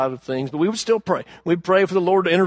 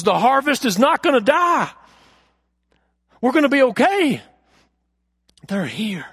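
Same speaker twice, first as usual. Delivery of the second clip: emotional; 7 semitones higher than usual. Real, not acted. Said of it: "I get emotional right now".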